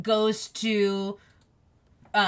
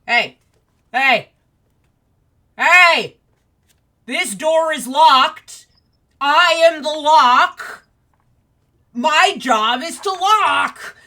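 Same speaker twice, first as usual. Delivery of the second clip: loud, high, slow shouting lock; 7 semitones higher, 12 dB louder, slower than usual. Acted. Lock yells from inside at surrounding worms, loud shouted voice. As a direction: bellow each word as an indignant talking lock. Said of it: "you hear a voice from inside going".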